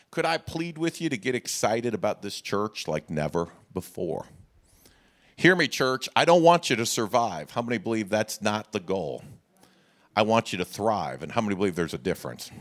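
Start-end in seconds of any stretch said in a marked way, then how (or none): none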